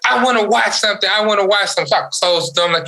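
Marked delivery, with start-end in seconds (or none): 0.1-1.9 put-on high whining four-year-old; pitched-up child begging; whiny kid voice. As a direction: whine it like a little kid wanting TV. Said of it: "He was like".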